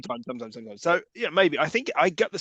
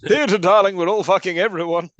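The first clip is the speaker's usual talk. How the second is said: louder, higher, over-the-top theatre darling; hammy luvvie; 5 semitones higher, 7 dB louder than usual. Pretend. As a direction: camp it up like a self-important stage actor. Hamming up a luvvie actor.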